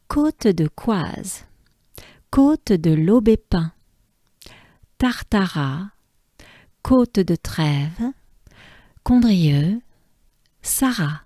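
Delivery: measured native French place names; French pronunciation. Shown as a demonstration. Teacher listing stage names for Francophiles, French pronunciation. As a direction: read each name distinctly in French. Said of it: "for all the French speakers out there".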